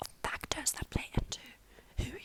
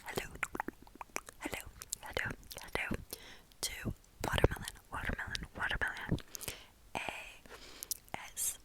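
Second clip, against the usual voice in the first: put-on ASMR whisper in British accent; 3 dB louder than usual. Vlogger whispering to viewers in a British accent try. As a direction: breathe it into the mic, attempting British.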